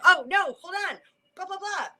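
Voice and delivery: high, excited mock interruption. Speaker blurting when triggered by someone's words, excited. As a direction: jump in high and loud, babbling at the end. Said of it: "that got me excited".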